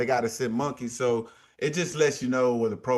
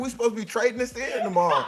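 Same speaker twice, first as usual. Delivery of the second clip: imitating himself; 10 semitones higher, faster than usual. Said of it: "I'm like".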